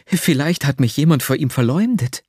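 plain reading voice

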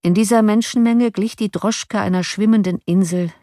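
ordinary delivery

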